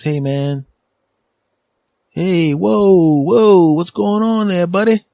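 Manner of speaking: high, loud excited clerk; store clerk voice. Retold or imitated. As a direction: play the hyped-up shop guy calling out. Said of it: "and he goes".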